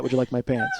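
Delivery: "Would you like my pants?" said soft, low and flat; deadpan replay. Retold or imitated. To the audience, repeating "Would you like my pants?" soft and deadpan. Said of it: "nobody would say that".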